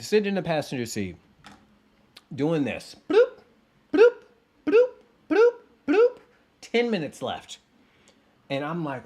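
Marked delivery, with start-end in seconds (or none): 3.1-6.2 high, loud little game-sound bloops; mock game bloops. Friend's Candy Crush in the passenger seat, mimicked bloops. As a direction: pop each bloop like a phone game chime.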